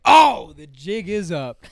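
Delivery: loud, high, drawn-out mock panic; mock alarm. Storyteller recalls being asked for a selfie, loud mock dismay. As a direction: blurt it slowly with a big theatrical "Oh".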